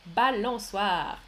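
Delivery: Balançoire said slowly, every syllable clear; slow spelling-out. Shown as a demonstration. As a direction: stretch out Balançoire for learners. (Balançoire, lentement et bien articulé)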